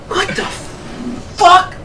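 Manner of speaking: very slow, high, breathy hush; stunned disbelief. Retold or imitated. Bystander told not to worry about screams, slow stunned hush. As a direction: stretch each word out, barely voiced.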